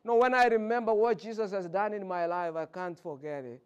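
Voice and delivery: ordinary talk, nothing marked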